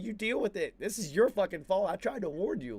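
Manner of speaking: high, heated mock scolding; retold exasperated scolding. Refusing to help the mother-in-law, exasperated retold scolding. Scolds her, retelling the argument.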